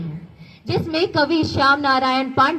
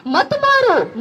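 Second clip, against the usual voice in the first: high-pitched call inside a recited poem; pleading cry; 12 semitones higher, 6 dB louder, slower than usual. A narrator's reading. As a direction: cry it out high, like soldiers begging for mercy. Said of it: "Mughal पुकार हुई". (ऊँचे सुर में गुहार भरी पुकार)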